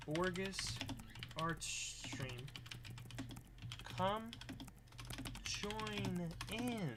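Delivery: soft, drawn-out dictation; composing aloud. Real, not acted. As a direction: sound out each phrase while writing it.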